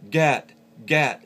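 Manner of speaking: GAT high and loud, falling; loud, high-pitched demo of GAT. Shown as a demonstration. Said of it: "is that higher pitched voice".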